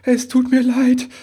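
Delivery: very high, loud little-elf wail; tearful apology. A narrator's reading. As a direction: plead for forgiveness in a high, upset voice. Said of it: "begann der Wichtel erneut". (hoch und weinerlich entschuldigend)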